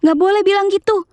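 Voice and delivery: high-pitched mock reproach; scolding hush. Grandmother hushing dad for his remark, high-pitched acted scolding.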